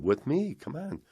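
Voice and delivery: imitated coaxing invitation; insistent urging. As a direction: pull a friend along with your voice. Retold friend insists he come.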